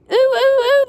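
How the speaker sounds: high rising squeal of ooh; trucks crying out. Acted. Trucks bumping into each other, crying out in a high squeal. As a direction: let it climb into a surprised squeal. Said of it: "they cried again".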